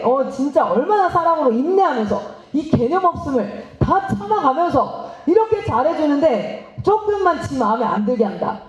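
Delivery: normal talking voice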